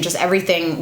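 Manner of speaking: ordinary talk, nothing marked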